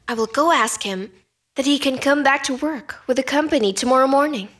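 usual speaking voice

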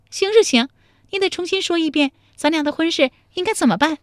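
high, fast bossy voice; demanding young woman. Acted. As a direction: make him say it again. 陶三春 demands he repeat. (强势地命令)

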